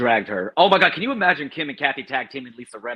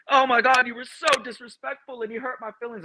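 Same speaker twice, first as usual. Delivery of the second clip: higher, whiny mock complaint; mocking Kyle; 8 semitones higher than usual. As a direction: pitch it up like a put-upon victim. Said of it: "it went from".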